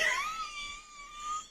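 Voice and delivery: very high wheezing laugh, almost crying; helpless squealing laughter; shrieking laugh. Real, not acted. Punchline laughter.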